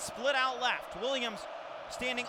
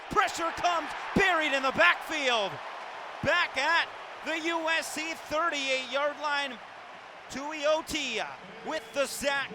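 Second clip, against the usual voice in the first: loud, higher burst easing off after the hit; excited sack call; 8 semitones higher, 4 dB louder, slower than usual. Real, not acted. Sportscaster calls the quarterback buried in the backfield, excited play-by-play. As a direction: punch out the big defensive play.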